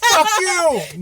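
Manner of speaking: high, laughing mock yell; mock outburst. Host jokingly cursing out his absent friend, high laughing yell. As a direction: yell it at him in fake outrage.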